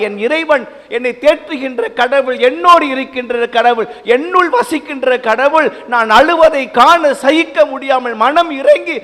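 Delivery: high, loud fervent blessing; God blessing the weeping man, fervent and loud. Acted. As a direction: proclaim the promise with fervour. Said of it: "என்று கடவுள் நம்மை வாழ்த்துகிறார்".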